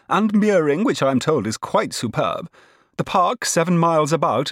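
high, emphatic, tart insistence. A narrator's reading. Cousin lists the suitor's riches, tartly. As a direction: rattle off the prize tartly, high. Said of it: "said Missus Malfrey tartly".

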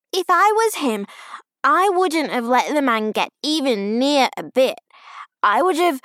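put-on high, loud child voice; cross child laying down the law; boastful little girl. A narrator's reading. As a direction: brag indignantly like a five-year-old. Child boasting indignantly to aunt.

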